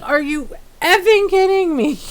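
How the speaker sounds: high-pitched incredulous retold outrage; so mad. Streamer complaining to viewers about O B S, acted mad. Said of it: "I'm so mad".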